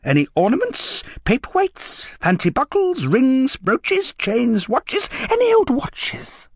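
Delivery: high, rising coaxing list of wares; eager dealer list. A narrator's reading. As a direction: reel them off hungrily.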